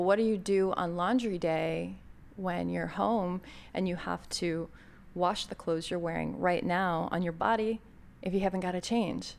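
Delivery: normal talking voice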